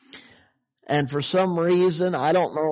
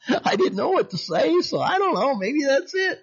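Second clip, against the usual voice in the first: laughing; 11 semitones higher than usual. Real, not acted. Laughing at his own story.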